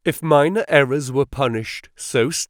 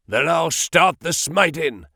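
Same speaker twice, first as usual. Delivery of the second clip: furiously; 5 semitones higher than usual. A narrator's reading. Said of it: "exclaimed Ferrier furiously".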